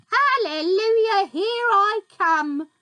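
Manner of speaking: high, loud gleeful whoop; shouted whoopee. A narrator's reading. Grandma shouting with joy as she rises. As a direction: whoop it with wild glee.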